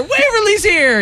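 high shouted announcement; mock cheer; excited shout. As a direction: call it out like a surprise arrival. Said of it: "and it's like".

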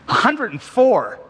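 high, drawn-out amazement at the number; amazed exclamation. Real, not acted. Repeats the age in amazement.

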